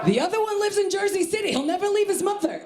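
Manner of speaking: very high East Coast aunt; Aunt Sherry. Acted. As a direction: complain as a high-pitched, fretful mother. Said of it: "I do that impression".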